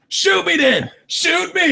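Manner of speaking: hammed-up shoot me; mock reckless dare. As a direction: dare the gunman like a fool. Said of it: "I wouldn't expect people to be like".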